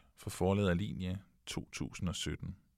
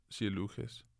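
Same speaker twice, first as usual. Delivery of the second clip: plain reading voice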